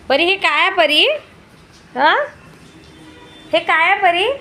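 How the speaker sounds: high, loud playful telling-off. Real, not acted. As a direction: scold the little one half in play. Mother scolding toddler over toys.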